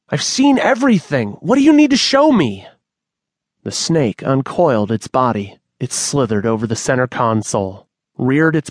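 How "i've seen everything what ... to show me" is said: panicked outburst. Acted. Manny panicking at the shadow.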